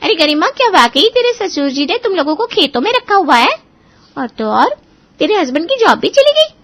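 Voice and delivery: high, loud, incredulous questioning; gossip-fuelled disbelief on the phone; shocked friend. Acted. Sona phoning friend Garima about the lost job, shocked and high. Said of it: "सोना गरिमा को phone लगाती है".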